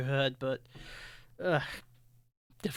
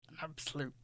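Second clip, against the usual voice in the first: whispered insult; 7 dB quieter than usual. Acted.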